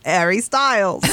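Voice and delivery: mock Cockney with a dropped H; Cockney accent. Teasing a singer about how he says his own name, mock Cockney. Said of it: "he speaks with a Cockney accent".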